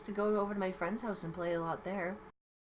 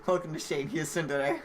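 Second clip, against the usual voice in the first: louder, laughing through the words; 2 semitones lower, 7 dB louder than usual. Real, not acted. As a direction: giggle out the joke. Joking remark through laughter.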